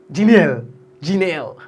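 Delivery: Janelle slapped out loud as "jee-NEL"; tongue-slapped name. Retold or imitated. Host mocks Grenadians saying Janelle, slapping it out. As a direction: slap out Janelle hard and carelessly. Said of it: "They just slap the tongue all over it".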